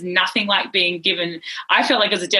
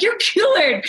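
excited insistence; 12 semitones higher than usual. Retold or imitated. Husband telling his wife she's cured, excited high insistence. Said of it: "I'm like".

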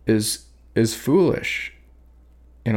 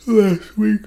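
yawn; 13 semitones higher, 4 dB louder than usual. Real, not acted. Tired poet yawning mid-sentence, then apologising. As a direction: voice cracking high into a yawn. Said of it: "So sorry".

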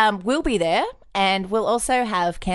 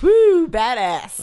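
mock hype; 3 semitones higher, 6 dB louder, much slower than usual. Mock hype about leprechauns.

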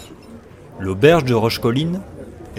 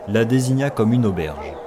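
plain reading voice

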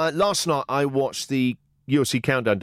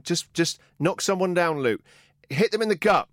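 mock coach shouting; 7 semitones higher than usual. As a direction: egg on a fighter, half-shouted. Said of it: "he's shouting at them".

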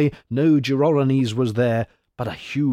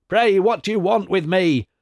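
put-on higher-pitched cry of the Old One; 8 semitones higher, 4 dB louder than usual. A narrator's reading. The Old One to Hercules who gripped him, crying out. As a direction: call it out high, an understated storybook plea. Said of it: "cried the Old One as soon as he could take breath".

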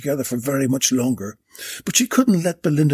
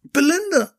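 sudden realisation; 13 semitones higher than usual. A narrator's reading. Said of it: "she thought".

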